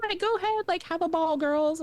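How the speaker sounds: high singsong go-ahead, gliding down; breezy permission. Retold or imitated. Adults waving the girls aboard, high and breezy. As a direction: mimic easygoing adults in a high voice.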